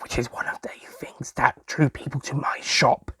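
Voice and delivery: quiet boastful whisper; whispered aside. Acted. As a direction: say it like a little secret.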